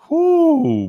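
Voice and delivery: loud high whoop of disbelief; shrill whoop; high whoo. Real, not acted. Reacting to verdict.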